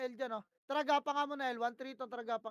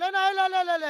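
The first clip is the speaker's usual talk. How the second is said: loud, higher repeated L calls; shouted callout; 6 semitones higher, 14 dB louder than usual. Real, not acted. Shotcaller yelling the lane to his team in a fight, loud shout. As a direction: yell the lane over and over. Calling teammates to L mid-fight.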